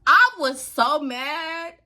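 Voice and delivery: ordinary delivery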